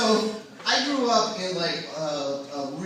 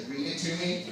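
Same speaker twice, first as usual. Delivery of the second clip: ordinary talk, nothing marked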